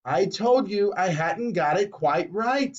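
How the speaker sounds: plain reading voice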